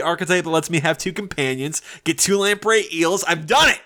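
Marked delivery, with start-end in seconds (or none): 3.2-3.8 1 triumphant. Real, not acted. Triumph at completing the build.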